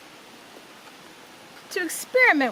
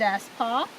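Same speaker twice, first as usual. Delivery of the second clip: plain reading voice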